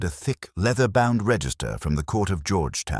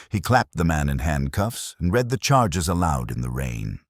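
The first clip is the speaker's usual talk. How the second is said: ordinary delivery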